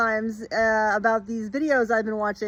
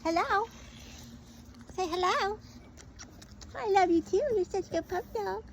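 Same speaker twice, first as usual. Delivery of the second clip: squeaky praising a dog; 11 semitones higher, 6 dB quieter than usual. Real, not acted. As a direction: coo at the dog in a high pet voice. Talking to her dog Dakota.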